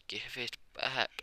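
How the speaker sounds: mildly whiny demand, drawn out; mock tearful plea. Juno, starting to cry, faces the drunk thief, mock tearful plea. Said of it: "Juno would start to cry now".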